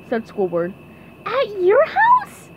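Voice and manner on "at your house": slow, loud, very high squeal of wonder; SpongeBob. Acted. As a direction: ask it awestruck, drawn out and squeaky. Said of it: "SpongeBob's eyes were huge".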